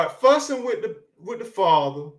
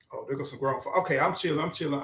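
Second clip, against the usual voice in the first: softer, lower voice of someone backing down; backing off quietly; 6 semitones lower, 8 dB quieter, much faster than usual. Retold or imitated. Young folks backing down on seeing grown folk, softer and lower. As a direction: ease off like the grown folks just showed up. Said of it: "people used to be like".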